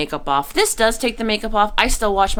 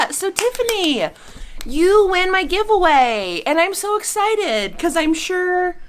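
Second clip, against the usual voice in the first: excited; 12 semitones higher than usual. Real, not acted. Congratulating winner.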